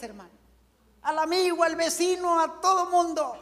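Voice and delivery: high, bright joyful preaching; joyful exhortation. Acted. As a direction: lift them into joy. Preaching joy upward. (predicando con alegría)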